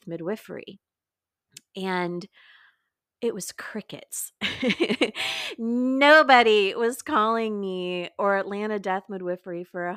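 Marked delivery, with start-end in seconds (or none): 4.4-5.5 quick, breathy chuckle; wry laugh. Real, not acted. Midwife admitting her business got no calls, amused laugh. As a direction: laugh briefly at your own flop.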